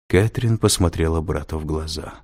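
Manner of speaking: plain reading voice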